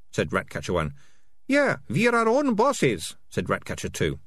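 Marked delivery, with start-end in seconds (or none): 1.5-3.2 chirpy thug. A narrator's reading. Ratcatcher Two chiming in.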